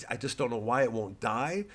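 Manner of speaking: normal talking voice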